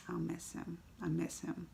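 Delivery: very soft, real sadness; tearing up. Real, not acted. Fan repeating Stan Lee's cameo line, sadly. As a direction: barely voice it, holding back tears. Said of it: "I'm starting to tear up".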